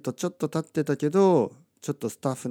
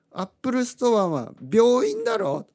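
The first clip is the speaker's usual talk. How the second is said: mock indignant protest; 12 semitones higher, 4 dB louder, slower than usual. Inner retort to chatty staff.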